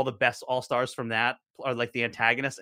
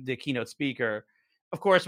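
ordinary talk

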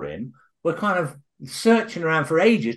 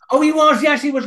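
surprised; 13 semitones higher, 7 dB louder than usual. Real, not acted. Surprised at spy revelation.

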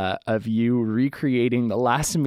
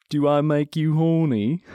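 cheeky put-on British swinger voice; hammed-up British playboy; Austin Powers; 3 semitones higher than usual. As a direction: do a toothy, flirty British spy impression. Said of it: "I do a little bit of an Austin Powers".

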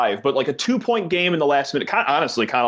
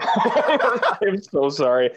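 laughing apology; 7 semitones higher, much slower than usual. Real, not acted. Laughing sympathy after Iowa loss.

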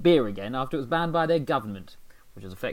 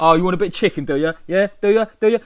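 mock taunting Brit; 5 semitones higher, 11 dB louder, much faster than usual. Mock British soldier taunting Indians.

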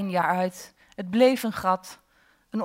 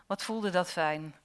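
usual speaking voice

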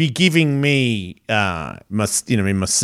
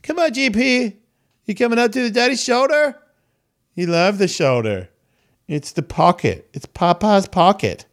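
coaxing pet talk; 7 semitones higher than usual. Real, not acted. Calling his cat to the daddy pocket, high pet talk. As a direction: coax the cat up in a high, doting voice.

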